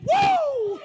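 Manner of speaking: high, loud whoop of praise; joyful whoop. Real, not acted. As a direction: let out a whoop. Shout of praise in sermon.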